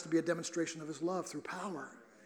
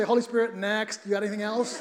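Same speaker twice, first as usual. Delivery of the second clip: mock impatient plea; 3 semitones higher, 8 dB louder than usual. Preacher retelling his plea to the Holy Spirit, loud mock impatience. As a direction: ask loudly for another option, half joking. Retold plea for a different message.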